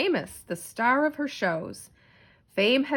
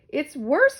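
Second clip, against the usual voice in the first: high, loud alarmed cry; 6 semitones higher, 6 dB louder than usual. Acted. Teacher crying out to the principal in alarm. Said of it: "cried Miss Sneecher".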